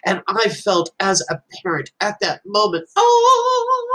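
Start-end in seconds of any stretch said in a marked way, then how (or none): 2.9-3.9 very high joyful Oh; excited gasp of joy. Real, not acted. Parent realizing son learned something, elated high Oh. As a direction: burst up in delight.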